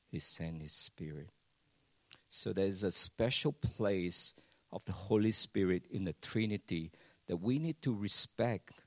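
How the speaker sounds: ordinary delivery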